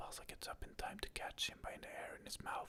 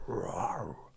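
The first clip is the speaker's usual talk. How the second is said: louder, lower cub roar; bigger roar; 14 semitones lower, 11 dB louder than usual. Acted. Simba's third try at the lizard, bigger roar. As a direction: push out a real roar. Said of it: "inhales deeply".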